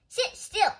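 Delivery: high, loud scolding command; sharp order. A narrator's reading. Mister Rogers orders bouncing Amelia to settle, sharply. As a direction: tell a fidgeter off loudly.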